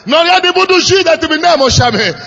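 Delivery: usual speaking voice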